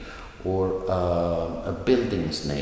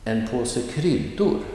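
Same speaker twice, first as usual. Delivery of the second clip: kryddor lifted high with a swoop; light on En påse, lean on kryddor; stress on kryddor; 4 semitones higher than usual. Shown as a demonstration. Teacher models an example phrase, stress on kryddor. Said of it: "the noun will get the emphasis".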